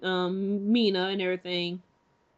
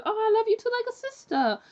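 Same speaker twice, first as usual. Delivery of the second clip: much higher, sugary friendly reply; sweet high Mina; 13 semitones higher than usual. Retold or imitated. Mina answering Lucy's confession, high and sweetly friendly. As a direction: chirp it sweetly, keeping her at arm's length. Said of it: "Mina replies".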